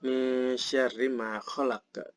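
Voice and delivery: slow verse, "khalaq" ending with echoed q; recited with released q on "khalaq"; qalqalah on khalaq. Shown as a demonstration. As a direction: bounce the last q of "khalaq". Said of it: "ada qalqalah lagi".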